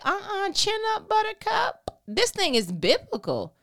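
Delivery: high mock-sassy Holy Spirit voice. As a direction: snap them out of self-pity, high and bright. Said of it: "Holy Spirit was like".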